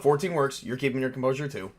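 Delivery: ordinary talk, nothing marked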